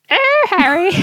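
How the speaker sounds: put-on the Queen greeting Harry in a British accent; posh British accent. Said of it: "my famous British accent".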